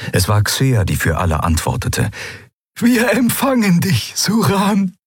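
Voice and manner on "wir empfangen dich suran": much higher, jubilant Xea; high, rejoicing greeting. A narrator's reading. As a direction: ring out with joy. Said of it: "sagte sie, und ihr Horn jubilierte".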